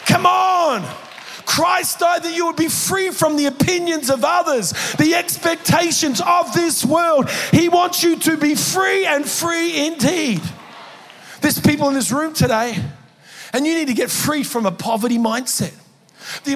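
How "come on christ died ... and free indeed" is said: high, loud, impassioned exhortation; full-force preaching. Real, not acted. Preacher urges the church to be free, preaching at full force. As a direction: drive it home to the whole congregation.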